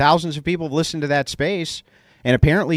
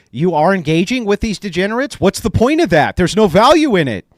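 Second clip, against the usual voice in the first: rising heated challenge; 6 semitones higher, 6 dB louder than usual. Real, not acted. Host challenging the guest, voice rising and louder. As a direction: pitch climbing, getting louder by the line.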